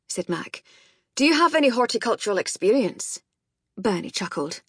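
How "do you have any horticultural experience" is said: quick, higher Scottish question; gruff Scottish. A narrator's reading. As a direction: fire the question off as a gruff Scot. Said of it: "Mac's gruff Scottish voice".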